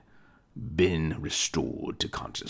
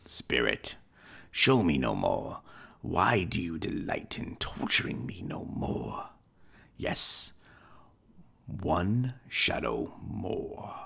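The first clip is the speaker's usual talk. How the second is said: put-on an old miser begging the spirit to stop; old Scrooge, pleading. Old Scrooge begs the ghost.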